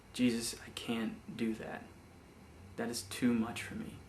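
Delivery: hushed, frightened plea to God; scared whisper. Retold or imitated. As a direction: whisper it as if afraid he means it.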